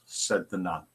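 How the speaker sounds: usual speaking voice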